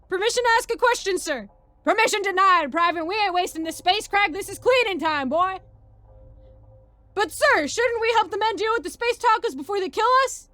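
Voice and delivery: loud, high barked argument; military yelling. Acted. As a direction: shout it like a boot-camp dressing-down. Sergeant berates questioning private.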